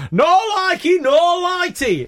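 imitating an over-keen fan yelling; heckling catchphrase fan. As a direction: holler the catchphrase across a showroom. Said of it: "this guy went".

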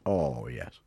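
very low, quiet advert dog; Churchill dog. Shown as a demonstration. Showing off the advert dog, deep quiet voice. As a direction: drop down into a deep talking dog. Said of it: "the Churchill dog from the advert".

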